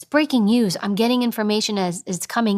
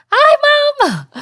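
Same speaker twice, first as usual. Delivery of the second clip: piercing, loud mock squeal of greeting; extremely high, sing-out kiddie greeting; high-pitched girl; 9 dB louder than usual. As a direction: squeal it like an exaggerated little girl. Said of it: "talk like this all the time".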